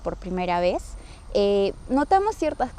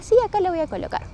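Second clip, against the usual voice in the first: quick, high-pitched insistent reply; retold insistence; 9 semitones higher, faster than usual. Daughter answers her mother's repeated doubt, quickly insisting. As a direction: brush off the doubt, pitched up and fast.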